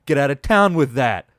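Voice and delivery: loud, excited disbelief; hyped exclamation. Real, not acted. Host reacts to a thirty-three yard catch, loudly excited. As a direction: shout it in delight.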